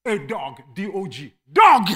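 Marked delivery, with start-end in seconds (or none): 1.5-1.9 loud sudden call; calling the dog. Retold or imitated. Owner calling his dog, loud call.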